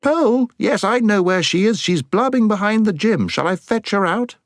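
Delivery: very high-pitched calling shout from afar. A narrator's reading. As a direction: call out shrilly across the school grounds. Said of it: "was heard shouting out".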